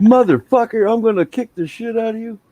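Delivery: high, loud outburst imitating an angry son; mock gamer rage. Dad imitating son cursing out an online opponent, mock rage. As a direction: explode at an opponent, voice jumping way up.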